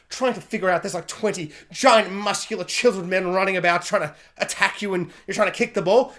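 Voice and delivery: normal talking voice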